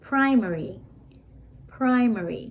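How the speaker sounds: Primary said clearly as a teacher's example; model pronunciation. Shown as a demonstration. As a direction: say Primary crisply for the class to copy. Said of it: "ออกเสียงได้สองอย่างไหม". (ออกเสียงคำว่า Primary ให้นักเรียนพูดตาม)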